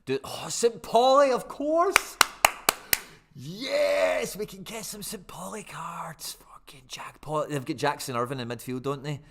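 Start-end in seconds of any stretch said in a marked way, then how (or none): 0.0-4.4 high, loud delighted squeal; excited. Real, not acted. Finds Saint Pauli cards. 4.4-7.5 slow gleeful whisper. Real, not acted. Whispers in glee.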